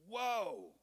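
high, loud startled cry; shocked whoa. Retold or imitated. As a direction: recoil in alarm. Same shocked reaction again.

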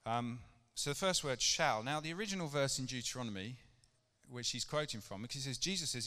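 ordinary talk, nothing marked

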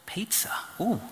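whispered, tempted "pizza? ooh"; intrigued whisper. Retold or imitated. Whispering to himself on hearing of free pizza. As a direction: breathe it like a secret treat.

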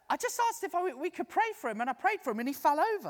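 high, loud, breathless explanation; squeaky hurried retelling; flustered woman. Retold or imitated. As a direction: imitate a rattled volunteer. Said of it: "She said".

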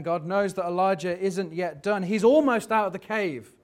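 ordinary delivery

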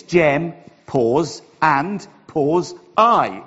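GEM, AND, I each set apart, with "pause" spoken between; spelled-out pauses. Shown as a demonstration. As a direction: separate "Gem", "and", "I" deliberately, announcing each pause. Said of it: "That's my Welsh accent".

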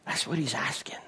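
hushed, breathy whisper; a confiding whisper to the congregation; whispered aside. Real, not acted. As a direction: lean in and whisper it. Preacher drops to a whisper.

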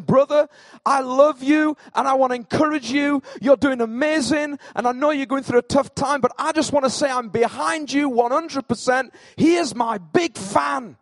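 high, loud, fervent cheerleading; gushing encourager. Acted. Encourager telling a brother he's behind him, fervent and high.

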